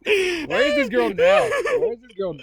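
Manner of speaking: high, loud, slow excited question. Real, not acted. Co-host reacting to the wild story, excited. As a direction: squeal it out in delight.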